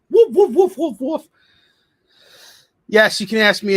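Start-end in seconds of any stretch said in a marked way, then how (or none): 0.1-1.3 high, loud comic barks; Curly. Retold or imitated. Imitating his excited dog for viewers, high comic barking. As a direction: bark in a slapstick falsetto. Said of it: "when he barks, he sounds like Curly".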